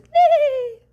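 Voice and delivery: very high, loud whinny; a loud high horsey whinny; horse neigh. Acted. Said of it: "His horse says".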